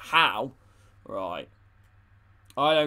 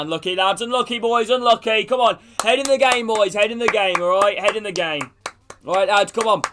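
higher, louder team pep shout; rallying call; 6 semitones higher, 6 dB louder than usual. Real, not acted. Streamer to his team after a miss, loud pep talk. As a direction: lift the lads after the miss.

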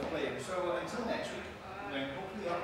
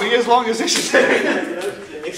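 loud laughing outburst; laughing hard; 8 semitones higher, 16 dB louder, slower than usual. Real, not acted. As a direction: crack up mid sentence. Cracking up mid take.

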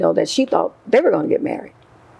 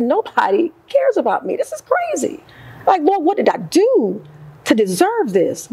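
despairing lament; 8 semitones higher than usual. Retold or imitated. Despairing cry quoted.

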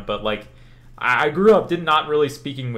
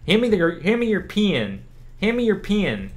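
higher, mocking Southern accent, "pen" said like "pin"; imitated Southern accent; 8 semitones higher than usual. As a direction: poke fun at a Southern classmate asking for a pen. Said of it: "People didn't pronounce E's".

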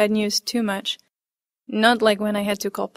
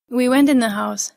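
usual speaking voice

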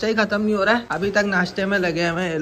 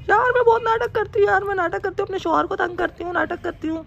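mimicking wife; 10 semitones higher than usual. Husband mimics his wife to the camera, high falsetto. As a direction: squeak it out like a pouty wife. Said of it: "mimicry कर रहा हूँ मैं".

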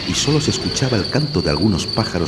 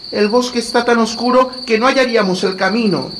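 put-on little girl voice=59; 4 dB louder than usual. A narrator's reading. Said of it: "añadió Gretel".

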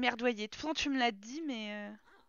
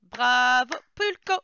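mock announcer shout; 8 dB louder, much slower than usual. Player celebrating her Sim's success with a mock announcer shout. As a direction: belt it out like a game-show host.

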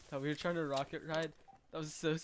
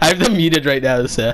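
loud laughing talk; 19 dB louder than usual. Real, not acted. Laughing while muted.

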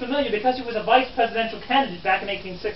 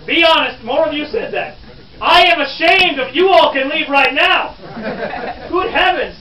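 loud, higher theatrical scolding; mock indignation; 4 semitones higher, 6 dB louder than usual. Guide ashamed of his audience, mock indignant scolding. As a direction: shame them with hammed-up outrage.

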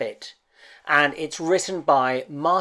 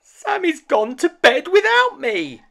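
very high and louder small toy voice; a little rabbit realizing he's forgotten; Tom Rabbit dismayed; 7 dB louder than usual. Acted. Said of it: "thought Tom Rabbit".

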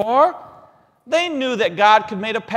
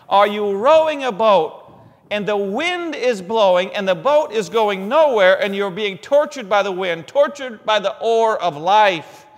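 impassioned preaching; 2 semitones higher, 5 dB louder than usual. Acted.

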